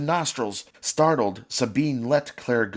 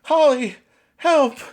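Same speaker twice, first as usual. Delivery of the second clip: put-on high, loud child's scream; screaming for help; 13 semitones higher, 6 dB louder, slower than usual. Trapped sister screaming to Holly for help. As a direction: shriek for your sister. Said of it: "children inside screaming".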